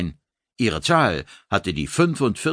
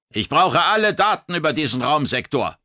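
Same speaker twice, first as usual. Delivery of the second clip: commanding officer; 7 semitones higher than usual. Acted. Commander orders data.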